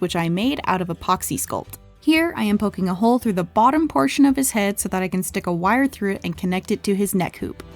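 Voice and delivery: ordinary talk, nothing marked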